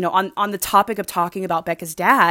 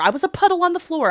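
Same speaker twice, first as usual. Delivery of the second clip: much higher and fast, overdramatic; dramatic repeat; 10 semitones higher, faster than usual. Real, not acted. Vlogger to her viewers, hamming up her crying, high and fast. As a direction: repeat it as a melodramatic wail.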